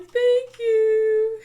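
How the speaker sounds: very high, loud gushing thank you; grateful thanks. Retold or imitated. Mom thanking husband for taking the kids, high gushing. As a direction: gush with relief. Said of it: "I'm like".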